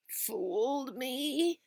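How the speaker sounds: slow croaking disbelief. Acted. Billy croaks at his father, slow and stunned. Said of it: "croaked Billy".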